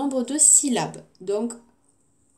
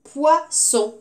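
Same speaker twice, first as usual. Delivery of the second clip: Poisson higher, split as POI, SSON; syllable by syllable; 6 semitones higher, 4 dB louder than usual. Shown as a demonstration. Teacher giving the next word, Poisson split syllable by syllable. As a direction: say Poisson in two clear, raised beats. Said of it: "Dans la dernière syllabe".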